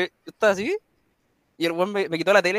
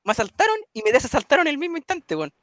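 high, louder, amused telling; high amused punchline; 8 semitones higher, 4 dB louder than usual. Real, not acted. Punchline of funny robbery story.

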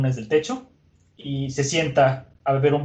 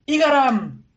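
high, loud cry of a name; alarmed cry; 11 semitones higher, 5 dB louder, slower than usual. Acted. Miss Wednesday crying out to Igaram, high and loud. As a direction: call out to him in alarm.

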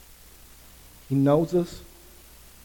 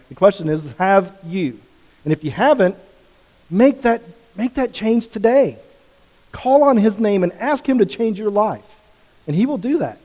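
raised, higher, louder pleading call; preaching at full force; 7 semitones higher, 6 dB louder than usual. Acted. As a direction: press them to decide now.

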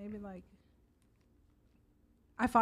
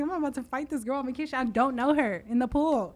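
higher, fired-up incredulous rant; ready to fight; 4 semitones higher, 4 dB louder than usual. Retold or imitated. Telling how she was ready to fight the girl, fired up. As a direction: build up like you're about to swing. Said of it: "I was like".

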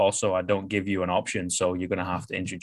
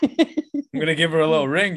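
slightly higher, playful put-on voice; a cheeky drunk deciding to phone; a lightly voiced tipsy punter; tipsy late-night caller; 6 semitones higher, 4 dB louder than usual. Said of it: "You're like".

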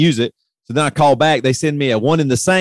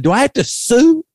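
retold exasperated outburst; 10 semitones higher than usual. Retold angry phone call.

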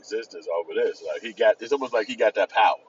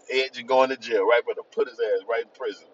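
normal talking voice

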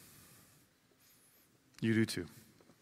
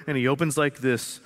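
normal talking voice

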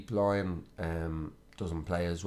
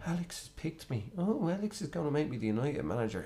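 high, softer voice of a thrilled underling; mock deferential; 7 semitones higher, 3 dB quieter than usual. Newly nominated manager awed by Ferguson, mock deferential. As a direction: imitate an overawed appointee, pitched up and hushed. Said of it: "he was just too deferential to Ferguson".

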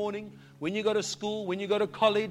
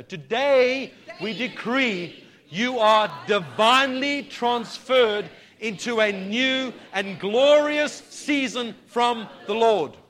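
louder, slower, driven decree; with gusto; 2 semitones higher, 6 dB louder, slower than usual. Shown as a demonstration. Pastor repeats the decree to the church with gusto. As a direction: proclaim it with full force of faith. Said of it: "Now we've got to say it with a bit of gusto".

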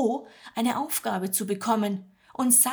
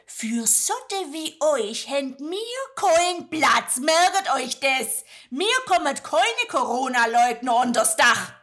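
brusque innkeeper; 5 semitones higher, 6 dB louder than usual. Acted. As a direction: cut him off harshly in dialect. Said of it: "Der Wirt unterbricht Jo barsch". (barsch, laut, schwäbisch)

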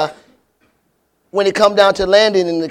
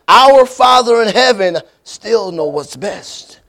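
ordinary delivery

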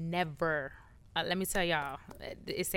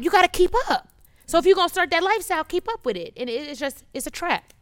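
ordinary talk, nothing marked

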